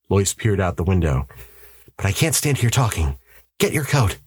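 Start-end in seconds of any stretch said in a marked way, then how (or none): 2.0-4.2 higher, lightly acted urgency; a touch of a husband taking charge; urgent husband. A narrator's reading. Husband hurrying his wife to leave, urgent.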